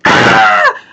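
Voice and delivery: short loud low yell of joy; excited scream. Retold or imitated. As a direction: shout out in triumph.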